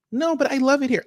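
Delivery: high-pitched put-on objection; protesting partner voice.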